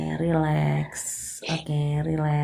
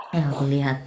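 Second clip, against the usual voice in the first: usual speaking voice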